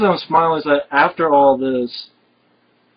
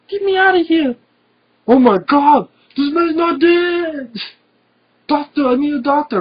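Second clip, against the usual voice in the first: much higher, loud, hammed-up fake panic; mock panicked plea; 12 semitones higher, 4 dB louder than usual. Hero fakes panic to trick a guard, high hammed-up yell. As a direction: play a hysterical victim, overdone. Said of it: "I just love Arnold playing like".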